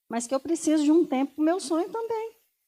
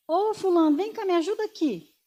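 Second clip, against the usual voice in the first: calling out; 6 semitones higher, 4 dB louder than usual. Retold or imitated. Coworker calling for help.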